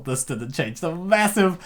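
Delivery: ordinary delivery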